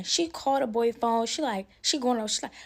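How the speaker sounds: ordinary talk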